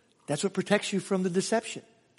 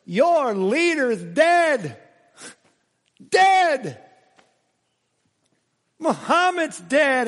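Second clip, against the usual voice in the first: louder, higher, slow declaration that their leader is dead; taunting proclamation; 7 semitones higher, 5 dB louder, much slower than usual. Acted. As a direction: hammer each dead like a challenge. Preacher taunting other religions' followers.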